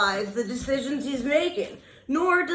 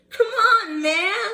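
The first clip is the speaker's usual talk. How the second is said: much higher, louder "come on, man" with a laugh; exasperated outburst; 9 semitones higher, 4 dB louder, slower than usual. Real, not acted. Scolding the president, exasperated laughing shout. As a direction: burst out in disbelief.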